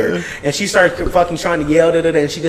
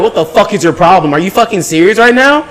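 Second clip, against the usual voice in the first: higher, firmer mock-angry telling off; retold angry scolding; 8 semitones higher than usual. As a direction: scold a rude customer, half replaying it. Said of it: "I was like".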